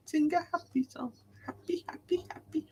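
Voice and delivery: very high, soft silly voice; tiny high-pitched happy chant; playful high voice. Pretend. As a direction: squeak it softly, playfully. Echoes the phrase in silly voice.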